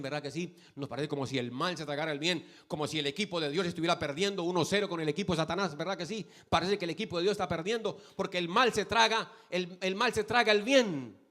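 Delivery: loud, high, driving sermon voice; full-force preaching. Acted. Pastor preaching loud to the congregation about good and evil.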